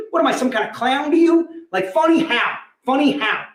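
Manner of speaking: menacing mock-offended "funny how", jabbing each question; Pesci impression. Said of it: "let me give you some Goodfellas".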